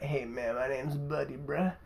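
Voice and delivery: low, very soft imitation of a dude; understated mock-cool voice, dropped low and quiet; imitated laid-back introduction. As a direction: play a low-key guy introducing himself, hushed. Voicing the rapper introducing himself.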